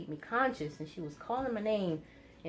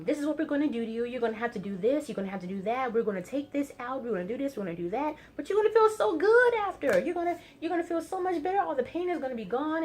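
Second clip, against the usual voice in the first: much higher, fast, rising into a louder cheerful burst; mock upbeat reassurance; 6 semitones higher, 4 dB louder, faster than usual. Doctor cheerfully promising the patient relief, hammed-up bright reassurance. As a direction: sell the surgery like good news, gushing. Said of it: "putting a little positive twist on it".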